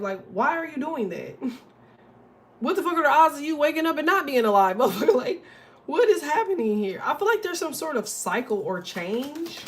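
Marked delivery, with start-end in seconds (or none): 2.6-7.0 louder, higher, fast disbelieving rant breaking into a laugh; incredulous outburst. Real, not acted. Ranting to viewers about odds of waking up, laughing loud disbelief.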